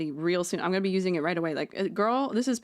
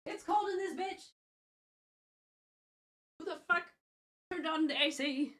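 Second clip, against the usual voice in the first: whiny complaint; 9 semitones higher, 7 dB quieter than usual. Real, not acted. Complaining about AC.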